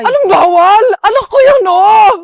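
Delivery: loud, high, desperate protest; anguished outcry. Acted. Mother shouts at the police to reach her dead child, loud and high. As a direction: shout through grief at the officer.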